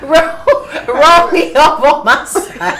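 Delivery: loud, slow and laughing; laughing plea. Pretend. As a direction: beg to be rolled over through giggles. Acting pregnant self.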